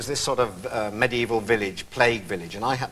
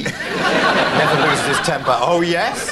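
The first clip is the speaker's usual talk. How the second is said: drawn-out mocking tone, a little higher and louder; sarcastic wife; 4 semitones higher, 6 dB louder, much slower than usual. The wife mocking his good-tempered image, drawn-out and sarcastic. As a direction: scoff at the nice-guy story. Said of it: "and she says".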